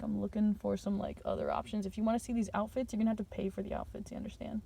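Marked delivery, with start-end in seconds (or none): 2.9-4.6 whispered aside. Acted. Hushed teasing aside about outfits.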